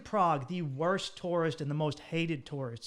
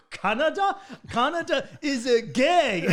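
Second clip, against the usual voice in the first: put-on loud, high, slow German-accented taunt; mock German kids' accent; 10 semitones higher, 8 dB louder, slower than usual. German kids taunting the Canadian, loud high German-accented mockery. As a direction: jeer it like drunk German teens, laughing. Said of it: "They go".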